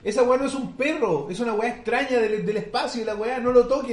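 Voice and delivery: loud, high, urgent warning shout; Swede's frantic warning. Retold or imitated. Guy in the movie warning Americans, loud high alarm. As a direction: warn them off the dog, half-yelling. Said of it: "le está diciendo en suizo o sueco".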